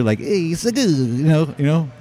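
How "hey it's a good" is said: put-on Italian accent. Waiter praising the food, fake Italian accent. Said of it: "like Italian, right?".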